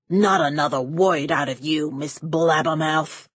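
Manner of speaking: a hint of a snappish Kleiner, slowing on the insult; snapped. A narrator's reading. Villain silencing the nosy heroine, a mild snap. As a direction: bite off the order. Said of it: "Kleiner snapped".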